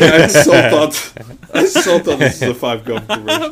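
laughing. Real, not acted. Laughing while telling.

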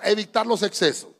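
ordinary delivery

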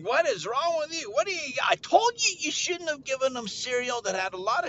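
high-pitched exasperated parent; scolding parent. Retold or imitated. Parent blames the other for sugary cereal, exasperated scolding. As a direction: scold in a high mock fuss.

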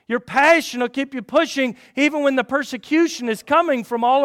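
loud, high, driving sermon voice; fiery preaching. Acted. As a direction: push the congregation with passion. Preaching at full force.

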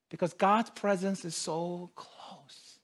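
suddenly soft and low; intimate hush. Acted. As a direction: drop to a near-whisper, as if very close. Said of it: "God whispers during our difficult time".

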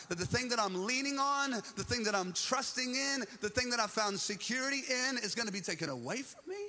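high, loud, building indignation; acted-out betrayed disciple in full rant; Peter. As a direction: hammer each grievance, pleading and outraged. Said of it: "here's Peter going".

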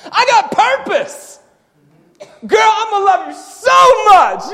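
full-force, high, shouted sermon; fired-up preacher, loud and high-pitched; impassioned preaching. Real, not acted. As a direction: preach it at the top of your voice.

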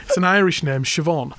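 normal talking voice

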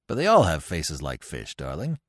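low fond father humouring his son; father's voice. Acted. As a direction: coo it down low to the child. Father soothing his bratty son.

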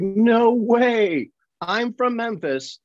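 high-pitched, drawn-out astonishment; imitated incredulous exclamation; acted disbelief. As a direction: play someone amazed and unconvinced.

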